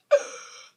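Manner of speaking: sharp unvoiced intake of breath, loud; loud gasp. Retelling the cheek kiss to viewers, a sharp reenacted gasp. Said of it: "and I was like".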